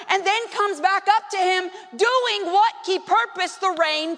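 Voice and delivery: rising preacher's cadence, building; impassioned preaching. Acted. Pastor lifting the congregation with the promise, building preaching. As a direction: proclaim the promise with mounting conviction.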